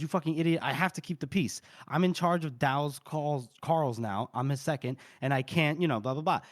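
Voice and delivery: usual speaking voice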